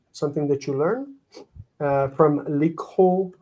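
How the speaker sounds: usual speaking voice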